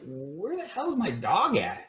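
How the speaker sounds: drawn-out, high-pitched puzzled question; retold bewilderment. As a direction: stretch it out high, half laughing. Retold puzzled question about missing dog.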